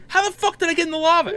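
high, fast mock disbelief; mimicked bewildered outburst. Gamer finding himself in lava, high bewildered mimicry. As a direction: squeal it quickly as the baffled friend. Friend baffled at landing in lava.

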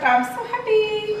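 high delighted I'm so happy. Real, not acted. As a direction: beam with joy. Delighted with gift.

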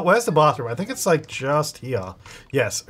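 usual speaking voice

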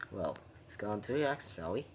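ordinary delivery

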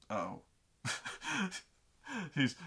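normal talking voice

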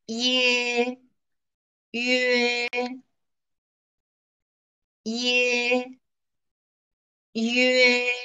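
clear, slow teacher's model of 耶 and 约; teacher reading 耶 约 for students to copy; model syllables for repeating. Shown as a demonstration. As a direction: say 耶 and 约 crisply for the class to echo. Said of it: "跟我读".

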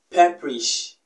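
ordinary talk, nothing marked

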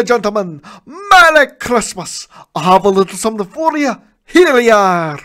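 put-on high, loud jolly old man. Reformed Scrooge handing coins to collectors, high and loud. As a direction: beam with generosity.

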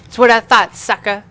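loud high gloating jeer; gloating shout. Real, not acted. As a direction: shout it smugly.

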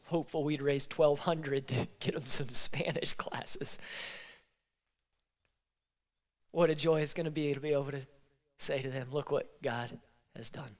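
tearful, voice breaking to a near whisper; fighting back tears while telling the good news; choked up. Real, not acted. Pastor telling the church about the family, choked with tears. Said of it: "I've been crying".

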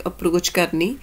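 normal talking voice

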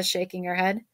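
ordinary delivery, nothing marked

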